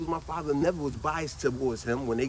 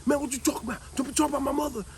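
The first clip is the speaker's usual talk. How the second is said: put-on higher, heated childish comeback; indignant kid retort; 7 semitones higher than usual. Boy fires back at his cousin about the mother, heated and higher.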